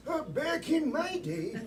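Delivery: put-on high, slow, laughing old-man voice; creaky grandpa telling a tale. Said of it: "the players are gonna pretend that they're".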